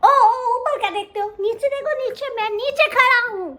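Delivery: high, loud little cub calling up; calling up from below. Acted. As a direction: shout up to the tall zebra. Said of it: "नीचे से बोला".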